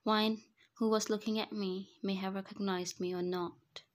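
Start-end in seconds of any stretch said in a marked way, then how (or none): none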